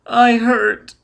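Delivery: put-on high, loud child's whine; whimpering child. A narrator's reading. Hurt boy to Foyle who carries him, whimpering high. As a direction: complain like a hurt little boy. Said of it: "Sigurd whimpered".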